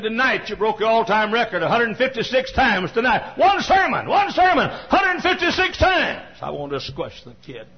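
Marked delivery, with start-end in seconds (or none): 0.0-3.4 put-on boy's excited report. Boy reports record coughing count. 3.4-6.4 high, loud and slowing right down on the count; incredulous shout. Real, not acted. Preacher to the congregation, astonished at the count, shouting high.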